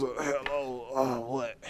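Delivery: low, slow, groggy imitation; hungover. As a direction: answer the phone as if badly hungover, barely audible. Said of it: "You were hungover when I called you".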